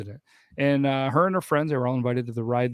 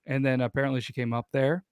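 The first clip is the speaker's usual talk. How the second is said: usual speaking voice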